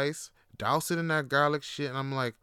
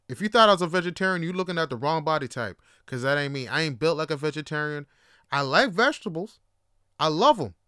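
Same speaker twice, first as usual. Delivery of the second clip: ordinary delivery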